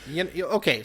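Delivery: ordinary talk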